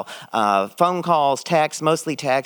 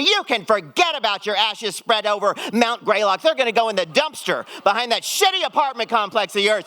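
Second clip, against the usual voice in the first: mock angry text; 8 semitones higher than usual. Angry revenge text to brother.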